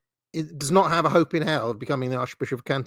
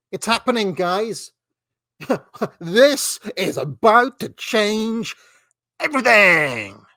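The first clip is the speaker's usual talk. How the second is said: high, drawn-out breaking-news hysteria; mock hype; 5 semitones higher, 4 dB louder, slower than usual. Host reads out a slop breaking-news post, mock hype. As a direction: ham up the sensational post, stretching the words.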